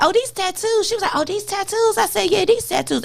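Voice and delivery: high, light voicing of a little girl and herself; childlike back and forth. Retelling the girl at the food line, high and light. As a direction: raise the voice for the kid's questions. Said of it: "I was like".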